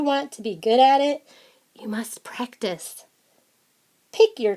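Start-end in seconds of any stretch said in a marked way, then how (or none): 1.7-3.0 whispered, leaning in on you must practice. Acted. Reader to the kids, whispered advice. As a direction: drop to a whisper to drive it home.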